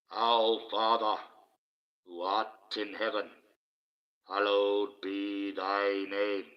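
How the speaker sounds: slow, level chant with drawn-out words; intoned prayer. Acted. Leading the chat in the Lord's Prayer, slow intoned prayer. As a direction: intone it solemnly, holding the long vowels.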